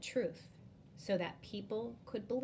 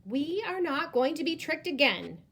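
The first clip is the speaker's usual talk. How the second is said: higher, louder scolding; angry; 8 semitones higher, 8 dB louder than usual. Acted. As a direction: scold the boy. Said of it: "they said to each other".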